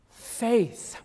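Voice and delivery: whisper. Real, not acted. Whispered repetition.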